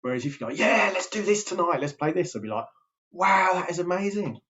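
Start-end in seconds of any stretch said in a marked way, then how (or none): 0.6-2.3 bright, much higher and louder burst of enthusiasm; energetic parent. Retold or imitated. Dad suggesting playtime to his kids, eager and much louder. 3.1-4.4 much higher, louder imitated wow of delight; delighted kids. Kids reacting to an energetic dad, imitated delight.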